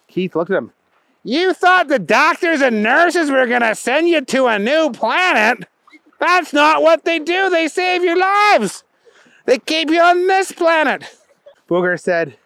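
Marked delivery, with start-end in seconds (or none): 1.2-11.2 high-pitched exasperated correction; incredulous buddy. A narrator's reading. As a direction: set your friend straight, high and emphatic. Keith corrects Booger.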